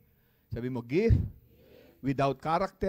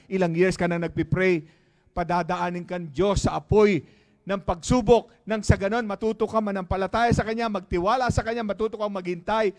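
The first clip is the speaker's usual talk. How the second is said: climbing louder and higher preaching; building sermon; 5 semitones higher, 9 dB louder than usual. Acted. Pastor pressing the church on trials, rising preaching. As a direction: build the fire line by line.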